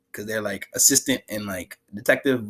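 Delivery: ordinary talk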